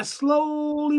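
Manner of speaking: a long, high, drawn-out "slowly". Retold or imitated. Acts out the slow walk away.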